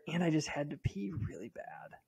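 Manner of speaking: embarrassed whispered admission; whispered aside. Real, not acted. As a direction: let it slip out in a whisper. Confiding an embarrassing need.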